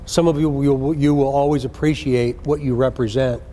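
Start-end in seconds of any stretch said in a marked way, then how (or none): none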